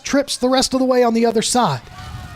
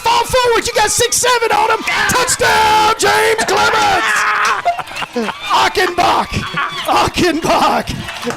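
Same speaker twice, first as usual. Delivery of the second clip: touchdown call; 12 semitones higher, slower than usual. Real, not acted. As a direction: belt out the touchdown call, stretching the name. Calling a touchdown play.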